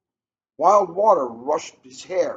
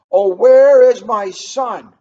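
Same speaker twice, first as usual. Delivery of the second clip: loud, slow, high wail; 6 semitones higher, 14 dB louder, slower than usual. Acted. Father calls to every jungle animal, slow loud wail. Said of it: "he wailed".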